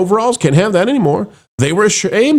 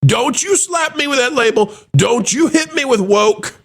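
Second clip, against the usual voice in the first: mock tech bro protest; 10 semitones higher than usual. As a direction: shout it like a panicked tech bro. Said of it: "Even the tech bros are saying".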